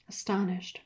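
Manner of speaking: ordinary delivery, nothing marked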